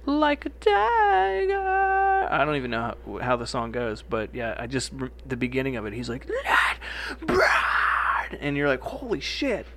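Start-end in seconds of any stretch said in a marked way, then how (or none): 0.0-2.3 long, high, drawn-out mock scream; imitating a singer's wild high scream; tiger scream. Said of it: "raspy scream going". 6.3-8.4 high, breathy screamed words; mock metal scream of the lyric; imitated raspy scream. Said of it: "he gets that raspy scream going". 8.8-9.6 high, amazed exclamation; stunned fan's exclamation; awed holy shit. Retold or imitated. Listener's awed reaction.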